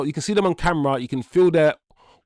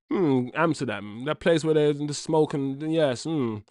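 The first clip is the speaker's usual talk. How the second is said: put-on posh English accent; 4 dB quieter than usual. Streamer coyly describing Amsterdam to viewers, in a posh English accent. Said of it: "that posh English accent".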